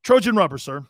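confident salesman announcing the stock name loudly; a loud, pleased sales pitch; proud loud pitch. Retold or imitated. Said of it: "he goes like this".

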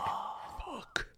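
breathless whispered fuck; whispered curse. Retold or imitated. Caught son whispering a curse to himself. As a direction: mouth it under your breath, caught. Caught sneaking out, inner reaction.

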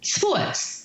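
mildly exasperated, high-pitched retort; retold exasperation. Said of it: "And I got so angry".

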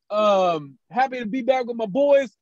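ordinary delivery

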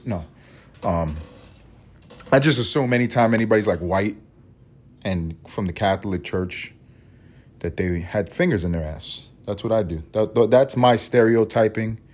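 ordinary talk

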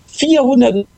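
high, astonished repeat of the number; can't believe the number; amazed. Real, not acted. Amazed at viewer count.

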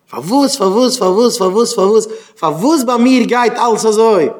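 high, repetitive mock whine of why; imitating an exasperated complainer; insistent complaining. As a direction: pile up the whys, pitched high. Mimics endless complaining to God.

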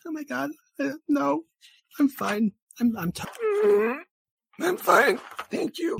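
very high, squeaky fake tearfulness; high-pitched choked-up valley girl; mock crying. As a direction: insist through put-on girly tears. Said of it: "like, cry again?".

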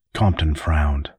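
ordinary delivery, nothing marked

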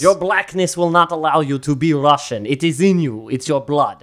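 deliberate, hammed-up Russian accent; mock Russian accent. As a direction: pronounce judgment as a mock Russian. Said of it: "good Russian accent".

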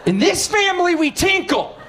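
high, prim fatherly decree; mock-solemn dad announcing house rules; stern dad rule. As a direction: lay down the family law like a prudish dad. Dad's bathroom word rule.

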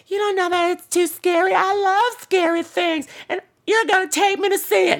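put-on very high, squeaky stubborn kid; little girl. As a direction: play a bossy tiny girl insisting. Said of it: "the little girl's like".

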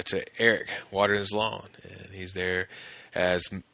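normal talking voice

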